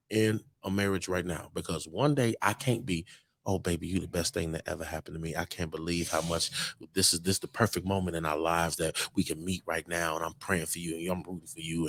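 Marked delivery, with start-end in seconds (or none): 3.5-11.9 low, soft lovey-dovey murmur; mock sweet-talk. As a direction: imitate a doting lover, soft and low. Mimicking a sweet-talking partner, then contrast.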